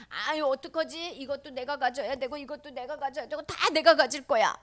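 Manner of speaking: whiny, put-on voice of wanting everything; fretful greedy child. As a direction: fret and grab for it all. Voices a fretting, grabby child.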